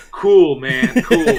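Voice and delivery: lightly voiced cool guy. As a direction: say it laid-back with a rising lilt. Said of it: "he's like".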